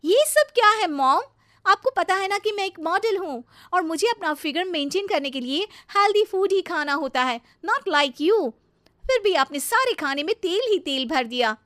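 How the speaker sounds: loud, high-pitched indignant outburst; flaring anger. Acted. Model bahu rails at mother-in-law over oily food, flaring up. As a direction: blow up at her over the food. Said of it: "गुस्से में भड़क जाती है".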